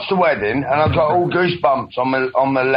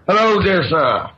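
ordinary delivery

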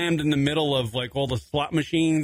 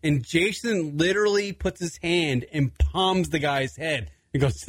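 ordinary talk

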